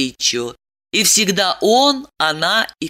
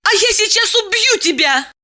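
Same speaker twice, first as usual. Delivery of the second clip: high-pitched furious woman; enraged threat. Acted. Woman threatens to kill her lover, enraged. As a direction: threaten him up high, incensed. Diana threatens him in a rage.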